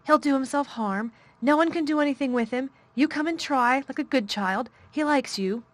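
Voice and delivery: high, loud, fast pleading; frantic hurry. A narrator's reading. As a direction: beg her to come, panicking. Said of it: "she said in a great hurry".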